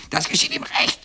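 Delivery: very high hen squawk. A narrator's reading. Hens endlessly taunting the boy, squawking. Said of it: "die Hühner mit ihrem ewigen".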